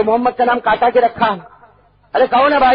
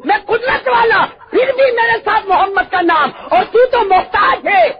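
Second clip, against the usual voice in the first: high shouted preaching cry; impassioned preacher at full force; fiery preaching; 9 semitones higher, 4 dB louder than usual. Acted. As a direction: thunder out God's words. Preacher shouting God's words.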